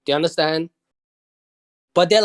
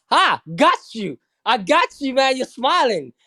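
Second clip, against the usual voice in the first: higher, crowing mock gotcha; playful teasing; 5 semitones higher than usual. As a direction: tease like you caught them out. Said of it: "you know, tease her".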